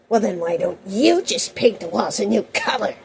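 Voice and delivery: mock a teacher's loaded comeback; exaggerated, swooping sarcastic retort; snide teacher retort. Acting out older teacher's retort.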